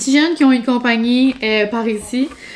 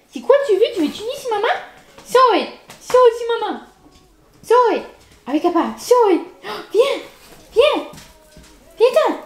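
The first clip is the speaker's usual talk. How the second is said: doggy mom voice; 10 semitones higher than usual. Real, not acted. Calling her dog Molly over, high cooing baby talk. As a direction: coo at the puppy like a doting mom. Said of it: "J'ai une voix de mère, de petit chien".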